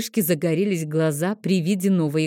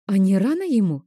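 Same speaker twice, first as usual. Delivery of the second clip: ordinary delivery, nothing marked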